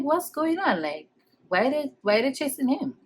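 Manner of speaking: soft, puzzled questions; quietly retold confusion of a watching child; hushed bewilderment. Child watching police chase her cousin, softly bewildered.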